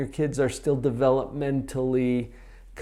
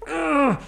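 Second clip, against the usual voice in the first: exasperated groan; 13 semitones higher than usual. Retold or imitated. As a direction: groan like a fed-up kid.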